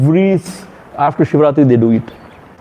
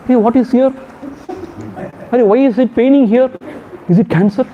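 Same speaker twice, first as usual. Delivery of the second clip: lightly voiced, higher fretting; anxious worrier; 8 semitones higher, much faster than usual. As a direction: fret aloud, a bit higher. Mimicking worrier about pain.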